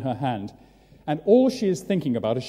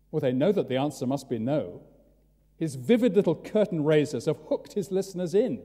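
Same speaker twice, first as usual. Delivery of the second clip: ordinary delivery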